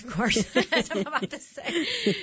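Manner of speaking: laughing loud and high. Real, not acted. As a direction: laugh through the words. Jokes about being right.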